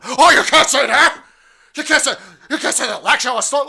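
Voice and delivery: high, loud, fast outraged voice; mock censor. Parodying YouTube scolding him, high loud fast mock outrage. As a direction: squeal like a scandalised censor.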